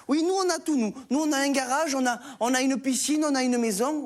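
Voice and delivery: put-on high, eager child voice; a kid bragging; boasting kid. Cédric brags to the teacher in a high eager voice.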